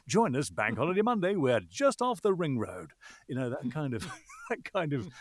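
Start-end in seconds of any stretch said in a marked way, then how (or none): 0.1-2.9 high, bouncy up-and-down commercial read; sing-song it like a cheesy local radio ad; eighties radio ad voice. Shown as a demonstration. Showing how eighties radio ad voices sounded, bouncy commercial voice. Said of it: "very high prosody, up and down".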